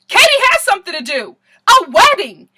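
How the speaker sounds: loud, high outburst; exasperated exclamation. Real, not acted. Vlogger ranting about the bride's priorities, exasperated. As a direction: belt it out as the obvious answer.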